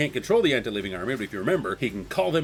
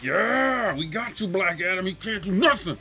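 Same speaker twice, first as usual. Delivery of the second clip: high, hyped-up hero cheer; carried away voicing a triumphant taunt; really excited; 6 semitones higher, slower than usual. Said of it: "I'm getting really excited".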